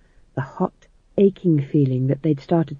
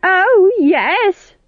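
put-on high, loud old woman's shriek; 6 dB louder than usual. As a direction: shriek it at her rival. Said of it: "shrieked Nanny".

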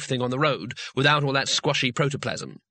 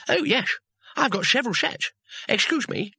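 much higher, mumbling without teeth; 10 semitones higher than usual. A narrator's reading. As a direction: talk as if dentures are in hand. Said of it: "in a more normal voice".

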